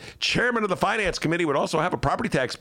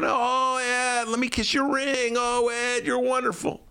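fawning supporter; 8 semitones higher than usual. Retold or imitated. Fawning donors at the fundraiser.

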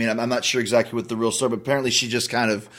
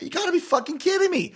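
pitched way up, incredulous and fed up; exasperated disbelief. Retold or imitated. Guest retelling being snubbed by Joan, incredulous high outrage. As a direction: throw the line up high in mock outrage.

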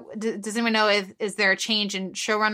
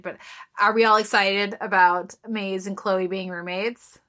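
normal talking voice

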